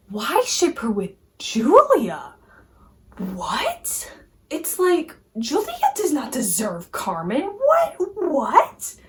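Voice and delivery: incredulous rant with jumping pitch; baffled outcry, loud bursts on the repeated "What"; exasperated disbelief. Real, not acted. Vlogger objects to fans pairing Julia with Carmen, incredulous. Ranting against a fan pairing.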